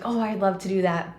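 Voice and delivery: normal talking voice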